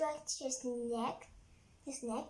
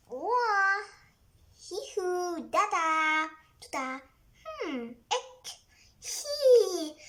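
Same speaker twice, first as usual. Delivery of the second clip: silly effort noises; 3 semitones higher, 5 dB louder, slower than usual. Acted. Rhino on seesaw noises.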